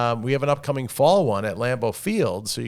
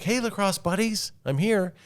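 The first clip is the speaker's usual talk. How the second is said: cheery greeting; 11 semitones higher than usual. Retold or imitated. Greeting other parents cheerfully.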